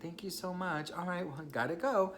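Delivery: high, sugary forced politeness; fake cheerful chatter. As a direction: pretend you're totally fine talking to the ex.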